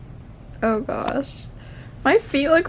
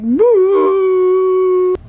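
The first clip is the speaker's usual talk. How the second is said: loud boo; 9 semitones higher, 9 dB louder than usual. Retold or imitated. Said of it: "you're like".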